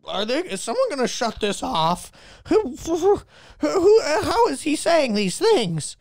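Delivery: high, sputtering mock outrage; offended celebrity. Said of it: "he was so offended".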